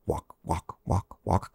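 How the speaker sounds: soft unvoiced whisper; whispered steps. Host tiptoeing in the dark, whispering. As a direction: whisper each step like a sound effect.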